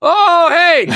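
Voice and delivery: excited greeting. Retold or imitated. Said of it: "yelling my name".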